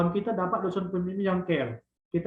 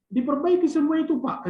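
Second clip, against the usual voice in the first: raised, higher pleading student voice; student pleading with the examiner, raised and high; crying student's plea; 7 semitones higher, 4 dB louder than usual. Retold or imitated. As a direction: beg the examiner, voice pitched up and loud. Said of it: "katanya gitu".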